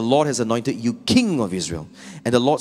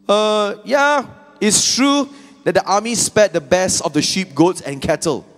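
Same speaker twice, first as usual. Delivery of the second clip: much higher and louder, shifty voice; excuse-making Saul; 10 semitones higher, 9 dB louder than usual. Acted. Saul excusing himself to Samuel, high and defensive. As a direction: wriggle out of it.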